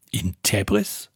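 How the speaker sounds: a dazed son echoing his father; disbelieving echo. A narrator's reading. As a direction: repeat the place as if you can't believe it.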